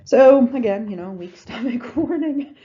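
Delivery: loud laughing heads-up; laughing warning. Real, not acted. Warning the squeamish again, laughing.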